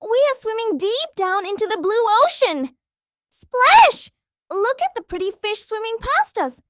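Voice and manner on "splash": loud playful splash; splash sound. Pretend. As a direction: shout splash like water hitting. Diving into ocean.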